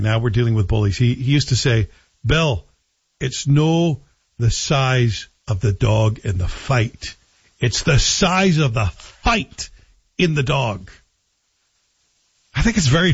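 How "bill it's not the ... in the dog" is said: dad's slow Scottish lilt, lightly done; a hint of a Scottish father giving advice; Scottish accent. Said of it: "said with a Scottish accent and told by my dad".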